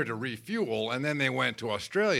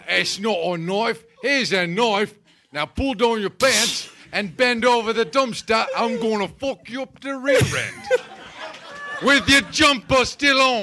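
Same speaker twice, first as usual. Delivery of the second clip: loud, high, broad Australian accent; hammed-up Aussie bushman accent, building in volume; 7 semitones higher, 6 dB louder than usual. Shown as a demonstration. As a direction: declaim it in a brash Australian accent. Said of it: "Say something Australian".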